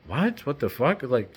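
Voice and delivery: high-pitched baffled questions; retold disbelief. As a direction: replay the shock, starting high. Acting own shocked reaction.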